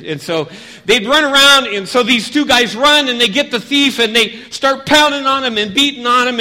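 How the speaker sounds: loud, high-pitched action narration; excited storytelling. A narrator's reading. Storyteller acts out the robbery for kids, loud and excited.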